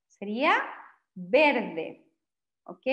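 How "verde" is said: "verde" dicho beɾðe, v como b, grave y marcado; verde con b. Shown as a demonstration. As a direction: pronunciar "verde" con b suave. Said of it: "¿cómo se pronuncia esa palabra?". (respuesta modelo: "verde" como b)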